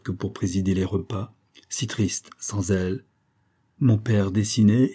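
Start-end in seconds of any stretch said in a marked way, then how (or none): none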